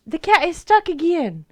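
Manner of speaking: real alarm that the cat is stuck. Real, not acted.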